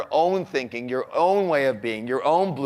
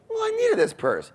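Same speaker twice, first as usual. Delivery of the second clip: mock wife in very high falsetto; defensive wife. Wife defends purse to husband, defensive falsetto. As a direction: imitate a wife justifying herself, pitched way up.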